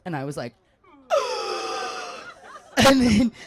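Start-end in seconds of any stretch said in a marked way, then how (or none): 1.1-2.7 tearful gasp. Retold or imitated. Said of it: "and then the tears".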